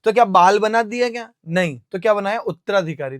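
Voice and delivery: normal talking voice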